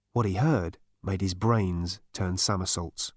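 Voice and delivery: ordinary delivery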